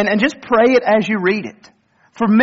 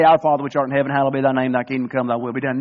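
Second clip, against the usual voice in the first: rote recitation; 6 semitones lower, faster than usual. Shown as a demonstration. Preacher showing the congregation a heartless rote prayer, lower and fading. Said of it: "Never go to God and just say".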